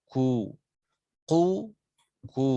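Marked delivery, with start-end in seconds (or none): none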